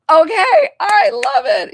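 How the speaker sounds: real delight, nearly shouting it out; excited shout. Real, not acted. Reader thrilled by the tattoo reveal, loud excited cry.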